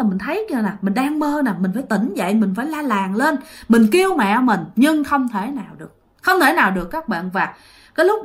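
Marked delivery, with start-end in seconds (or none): none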